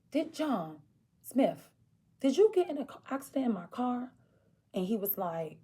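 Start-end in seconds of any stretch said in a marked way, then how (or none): 0.1-4.1 higher, pointed accusing question; upset confrontation. Retold or imitated. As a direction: demand the truth from him. Confronting him on the phone.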